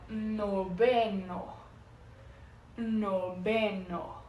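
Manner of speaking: very slow noveno with the v said as b; Spanish noveno. Shown as a demonstration. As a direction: say noveno as no-be-no, drawn out. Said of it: "a letra V com a pronúncia de B".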